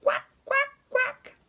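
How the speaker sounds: very high, short quacks; squawky high-pitched duck calls. Retold or imitated. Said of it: "what does the duck say".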